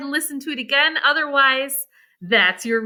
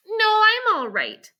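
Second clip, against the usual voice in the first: put-on higher, slower girl's voice; Jane; 8 semitones higher than usual. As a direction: brush off his concern as a young girl. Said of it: "she said".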